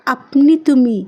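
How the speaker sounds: ordinary delivery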